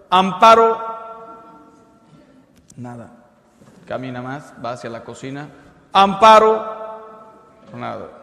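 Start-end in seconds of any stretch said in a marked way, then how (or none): 0.1-0.8 loud high shout from the doorway; calling out. Acted. Husband calling his wife from the front door, shouting. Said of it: "abre la puerta y grita". 5.9-6.8 loud, high-pitched holler; calling out. Acted. Husband calling his wife toward the kitchen, shouting. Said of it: "abre la puerta y grita".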